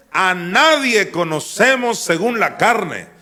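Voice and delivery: loud, slow, emphatic; the preacher declaims scripture loudly; preaching at full force. Shown as a demonstration. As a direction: hammer each word of the verse. Same verse read again plainly.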